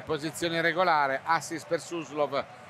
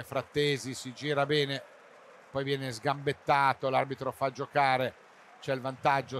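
usual speaking voice

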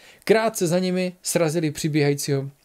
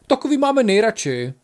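laughing; 7 semitones higher, 4 dB louder than usual. A narrator's reading. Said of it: "zasmál se Bík".

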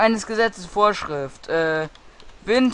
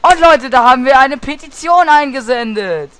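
excited announcement; 6 semitones higher, 7 dB louder, faster than usual. Real, not acted.

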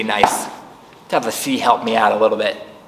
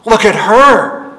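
higher, louder alarmed granny; alarmed granny gasping, higher; 9 semitones higher, 7 dB louder, slower than usual. Acted. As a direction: gasp it in alarm. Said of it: "Granny gasped".

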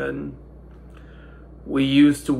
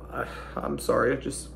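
quick, softer broken-off apology; choked up; 6 dB quieter, faster than usual. Real, not acted. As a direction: falter and fall quiet as grief hits. Breaks off, apologizing in grief.

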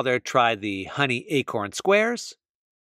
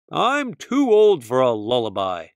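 louder, scoffing bear voice; laughing scoff; 2 semitones lower, 4 dB louder than usual. Acted. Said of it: "Bear laughed".

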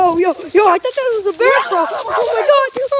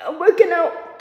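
ordinary talk

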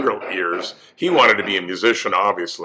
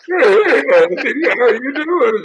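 much higher and louder, a cracking voice impression; Andy Devine impression; 4 dB louder, slower than usual. Guest jokes to the host about his laryngitis, high cracking impression. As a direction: squeak it out like a voice that cracks. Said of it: "I'm gonna sound like Andy Devine".

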